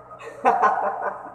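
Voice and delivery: high, breathy run of laughter; real airy giggle. Real, not acted. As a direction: laugh out in quick bursts. Laughs at chat between remarks.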